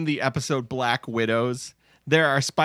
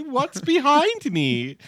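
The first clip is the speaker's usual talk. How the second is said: mock mother's question; 10 semitones higher, slower than usual. Mocking the repeated line.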